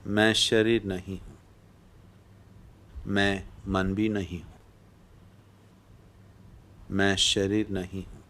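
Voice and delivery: ordinary talk, nothing marked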